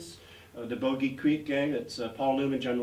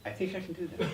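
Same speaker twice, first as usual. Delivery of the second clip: laughing; 6 semitones higher, 4 dB quieter, faster than usual. Retold or imitated. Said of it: "And I go".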